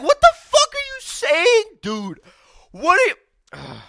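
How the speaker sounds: very high, loud outraged yell; too emotional. Retold or imitated. Vlogger answering the pundit's fasting advice, getting too emotional. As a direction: explode in worked-up disbelief. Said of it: "I'm getting too emotional".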